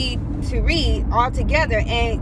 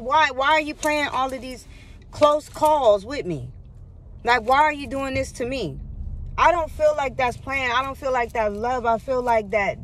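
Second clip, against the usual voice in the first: ordinary talk, nothing marked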